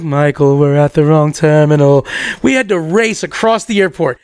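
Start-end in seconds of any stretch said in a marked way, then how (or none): 0.0-2.1 lightly mock a stoned friend; stoned people. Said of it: "Everybody who's high is like".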